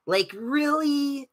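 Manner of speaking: drawn-out high disbelieving really; incredulous. Real, not acted. Streamer scoffing at the game's characters, incredulous. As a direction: stretch it out in disbelief.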